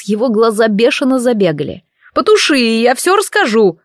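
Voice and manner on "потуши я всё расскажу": high, frantic begging; panicked pleading. A narrator's reading. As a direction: beg in panic. Burning innkeeper begs. (панически умоляя)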